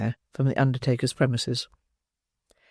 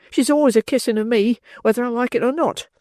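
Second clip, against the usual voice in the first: whining Noah; 9 semitones higher than usual. Acted. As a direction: blame her, snivelling.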